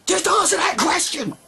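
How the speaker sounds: shouting in frustration; frustrated yell at theists; exasperated shout. Real, not acted. Demanding an answer from theists.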